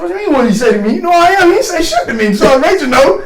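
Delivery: high-pitched, loud protest; mock indignant denial. Soldier denying to team sergeant he was chewed out, indignant. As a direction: brazen bluffing, voice jumping up in retold outrage.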